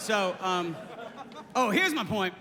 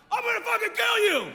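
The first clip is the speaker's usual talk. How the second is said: high-pitched mock yell; drunk jock threat; 10 semitones higher, 3 dB louder than usual. Drunk jock jumping out at someone, mock-furious yell. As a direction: holler it like a blustering drunk jock. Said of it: "some dumb drunk jock".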